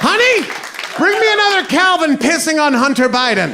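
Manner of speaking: high-pitched holler to a spouse; mock truck-owner yell; calling out. Imagined truck owner calling to his wife.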